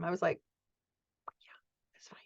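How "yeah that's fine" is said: breathy whisper, barely there; whispered reply. Retold or imitated. Telling a friend's mom it's fine, whispered. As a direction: whisper it so she won't hear.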